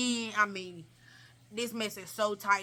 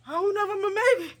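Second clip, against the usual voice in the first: high, rushed mock whimper; hammed-up distressed reply; acted distress; 9 semitones higher, 5 dB louder, faster than usual. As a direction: answer in a hurried, pained high voice. Acted pained reply to onlooker.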